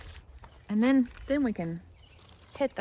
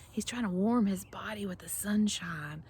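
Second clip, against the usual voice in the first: whisper; 5 dB quieter than usual. Acted. Hushed explanation about the snake.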